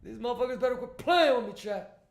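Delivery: fast high teasing voice; silly clown. Acted. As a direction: scold the chat like a cartoon clown. Mock scolding chat.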